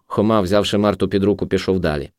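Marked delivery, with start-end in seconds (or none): none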